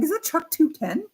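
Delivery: high-pitched starstruck question; voicing the dog. Owner speaking for the dog spotting Chuck, high-pitched. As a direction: squeakier voice, as the pet asking. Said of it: "She's like".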